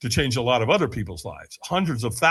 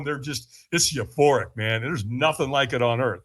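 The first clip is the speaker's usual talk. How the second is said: normal talking voice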